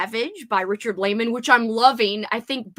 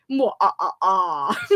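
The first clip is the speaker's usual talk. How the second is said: blown kisses; 3 semitones lower, slower than usual. Real, not acted. Blowing kiss to viewers.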